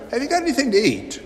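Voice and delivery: Jesus casually asking his friends for food. Acted. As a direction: toss it off playfully.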